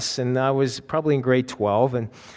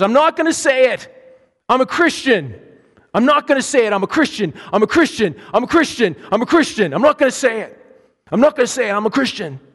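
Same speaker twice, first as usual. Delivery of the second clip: much higher, loud indignant outburst; snapping defiance; 9 semitones higher, 5 dB louder than usual. Retold or imitated. Tim standing on the bus refusing the bullies, loud defiant shout. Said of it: "Tim snaps".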